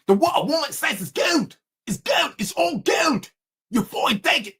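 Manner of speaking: high-pitched put-on London accent, heating up; England voice. Comic ordering a man to obey a woman, London accent. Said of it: "Gotta do it in my England voice".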